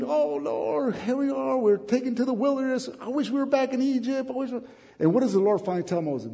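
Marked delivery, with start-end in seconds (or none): 0.0-4.6 higher, whiny mock lament; complaining Israelites. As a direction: moan to the Lord like a tired grumbler. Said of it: "they keep complaining".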